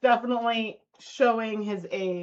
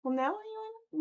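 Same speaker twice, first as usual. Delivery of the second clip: higher coaxing voice to the dog; pet talk; 12 semitones higher, 5 dB louder, faster than usual. Real, not acted. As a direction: coax the dog back into frame.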